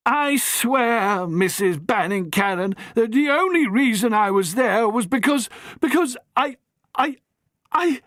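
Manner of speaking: put-on high, flustered, faltering young man; stammering young man. Harry pleads with the matron, stammering. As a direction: trip over the excuse.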